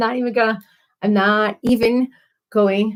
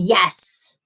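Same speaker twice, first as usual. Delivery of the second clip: ordinary delivery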